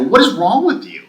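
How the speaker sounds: high, loud, exasperated question; indignant retold outburst; incredulous challenge. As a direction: demand it in re-enacted disbelief. Retold argument with state official.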